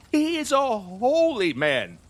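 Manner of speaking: ordinary delivery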